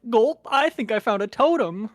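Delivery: high, loud, nervous gulp; scared horror game streamer. Retold or imitated. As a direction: play a jumpy scared survivor. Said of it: "he's like".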